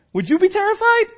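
very high, loud shouted question; loud challenge. Real, not acted. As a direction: fire the question at the crowd, shouting. Preacher challenges listeners at full voice.

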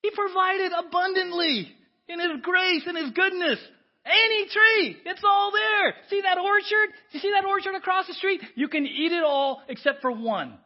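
high, loud, fervent preaching; preaching at full force. Acted. Preacher exhorting the congregation, high and loud. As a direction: proclaim the abundance to the room.

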